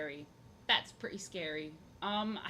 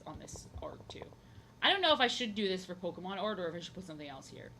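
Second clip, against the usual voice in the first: ordinary talk, nothing marked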